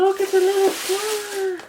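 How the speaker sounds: high, delighted coo. Real, not acted. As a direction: coo over something cute. Cooing at a cute flower.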